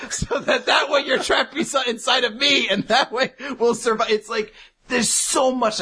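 laughing while explaining; high, giggling through the words. Real, not acted.

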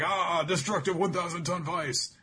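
high, mock battle cry; hammed-up anime attack shout; attack call. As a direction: announce the finishing move grandly. Calling out an attack name.

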